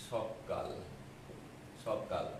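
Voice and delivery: সকাল said with a doubled k, sokkal; emphatic সকাল with a double k; doubled consonant. Shown as a demonstration. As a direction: hold the k in সকাল. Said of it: "সকাল না বলে আমরা সকাল বলি".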